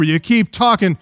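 normal talking voice

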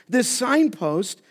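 ordinary talk

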